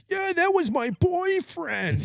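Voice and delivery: very high, drawn-out caricature voice; high-pitched parody of someone's girlfriend; imagined upset girlfriend. Retold or imitated. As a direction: put on a slow, squeaky mock-outraged voice. Imagined store clerk's upset reply.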